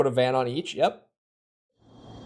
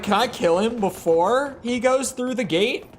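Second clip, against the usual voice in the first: mock eager; 8 semitones higher than usual. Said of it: "I was like".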